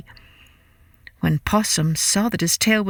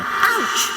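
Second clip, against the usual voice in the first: sharp high yelp of pain; ouch cry; 14 semitones higher than usual. Acted. Story opening cry.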